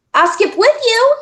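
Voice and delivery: put-on high, eager boy voice; eager boy offering. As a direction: offer to join in hopefully. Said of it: "he offered".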